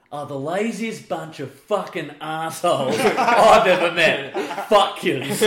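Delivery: retold rant, loud and sweary; angry coach tirade. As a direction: imitate a fed-up coach blowing up at the team. Acted coach's rant at the team.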